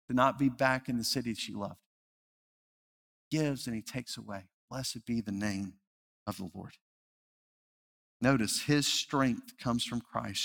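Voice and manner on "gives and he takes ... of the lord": softer, reverent repeat; a quiet, reverent echo of her words; hushed echo. Acted. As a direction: let it fall away to near silence. Echoing widow's words again.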